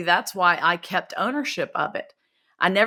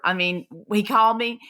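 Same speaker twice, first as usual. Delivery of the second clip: ordinary delivery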